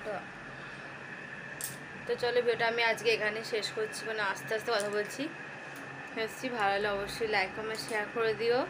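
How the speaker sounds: talking through clenched teeth from toothache, soft and careful; quiet pained words pushed through tight teeth. Real, not acted. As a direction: keep the jaw shut and speak gently, it hurts. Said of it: "দাঁত চেপে চেপে কথা বলছি".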